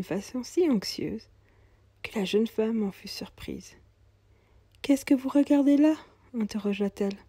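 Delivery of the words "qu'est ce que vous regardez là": put-on higher, plaintive young mother's voice; young mother, weary. Poor young mother telling the cobbler she's going to hospital, higher and plaintive. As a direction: sound tired and worried about her baby.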